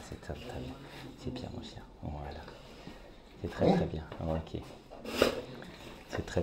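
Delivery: soft whispered praise to a dog; talking to a dog. Real, not acted. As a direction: fade to a hushed whisper, soothing. Soothing the nervous dog. (chuchoté doucement au chien)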